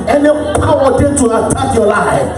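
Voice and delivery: loud, forceful prophetic declarations; full-force preaching prayer. Real, not acted. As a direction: shout each decree over the congregation, stretching the key words.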